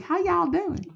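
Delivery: high-pitched mock Southern greeting; hammed-up Southern accent; terrible Southern accent. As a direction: put on a bad Southern twang. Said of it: "gonna have a terrible Southern".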